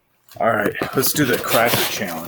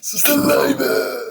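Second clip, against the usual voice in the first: burp it out high and slow; burping the words; much slower than usual. Shown as a demonstration. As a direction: stretch the word on a burp. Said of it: "I'm gonna do a balloon burp".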